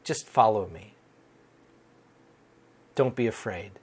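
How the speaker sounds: a hint of a kind Lord calming a worried man; gentle calm Lord. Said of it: "the Lord looking at me, shaking his head, going".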